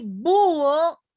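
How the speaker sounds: búho en dos sílabas, BÚ-o, con la ú fuerte; hiato marcado. Shown as a demonstration. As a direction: carga la ú de búho y sepárala de la o. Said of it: "se las pronuncia". (búho con la ú alargada y tónica, rompiendo el diptongo)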